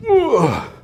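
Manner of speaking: high, falling grunt of exertion; real lifting strain; effort grunt. Real, not acted. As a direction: grunt as you heave the weight up. Grunts during final heavy rep.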